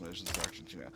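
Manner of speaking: ordinary talk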